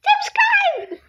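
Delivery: very high loud squeal. Acted. Calling out to viewers.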